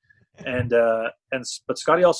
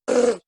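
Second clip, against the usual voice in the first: high, louder scoffing puff of air; dismissive scoff; 11 semitones higher, 5 dB louder than usual. Retold or imitated. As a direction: scoff at the asking price. Friend scoffing at five million.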